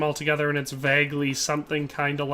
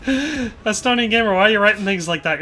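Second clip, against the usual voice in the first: put-on Irish accent; 4 semitones higher than usual. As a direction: draw it out in an Irish lilt. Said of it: "making me read 'em in an Irish accent".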